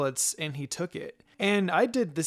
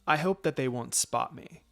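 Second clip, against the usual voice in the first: plain reading voice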